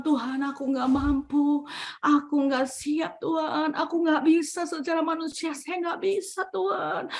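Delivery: soft, weary plea to God; quietly admitting helplessness to God; retold helpless prayer. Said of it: "saya berdoa sama Tuhan".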